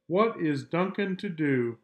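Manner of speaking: plain reading voice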